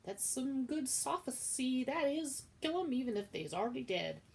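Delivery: dim kitchen maid. Acted. Midge agrees slowly.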